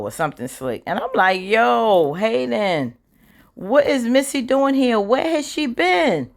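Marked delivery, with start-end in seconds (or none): 1.4-6.3 pitched way up, drawn-out disbelief; rising shock, slowing at the end. Real, not acted. Vlogger reacting to Missy showing up, shocked and high.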